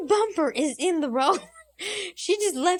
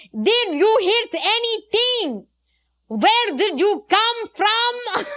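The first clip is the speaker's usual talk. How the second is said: higher, louder, very slow mock-cop voice; mocking the officer; 7 semitones higher, 5 dB louder, slower than usual. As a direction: exaggerate the officer's slow, loud questioning. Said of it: "this officer is like".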